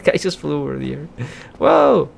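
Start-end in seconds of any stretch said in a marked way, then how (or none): 1.6-2.1 amazed whoa. Real, not acted. Enemy flies through the air.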